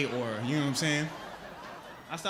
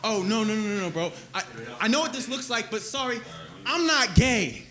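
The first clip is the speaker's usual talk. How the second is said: flustered denial; 6 semitones higher than usual. Retold or imitated. Other Prius driver denying.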